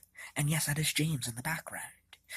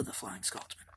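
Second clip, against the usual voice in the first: sudden whisper, much softer; hushed whisper; 8 semitones lower, 11 dB quieter than usual. Acted. Henry asking Gordon about his brother, whispered. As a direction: drop to a secretive whisper.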